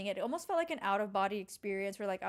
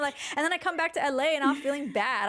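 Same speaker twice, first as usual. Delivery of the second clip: usual speaking voice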